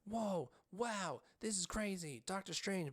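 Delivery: a hint of the gushing girl beside you; annoying fangirl gush. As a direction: ham up an overexcited fan. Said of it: "She was being mad annoying".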